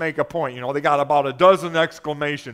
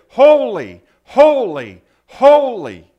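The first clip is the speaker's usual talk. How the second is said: calling out; 3 semitones higher, 11 dB louder than usual. Acted. Preacher voices seraphim calling to one another, loud reverent calling. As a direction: proclaim it loudly, each holy ringing out and falling. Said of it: "they were calling to one another".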